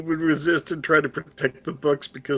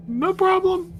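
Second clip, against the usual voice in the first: high squeaky reassurance. Real, not acted. Laughing, pretending fine.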